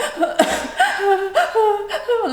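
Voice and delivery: high, halting tearless sobs; imitated baby cry. Daughter after crying for hours, imitated baby cry. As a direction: mimic a baby crying with no tears left. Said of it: "a baby cry like that".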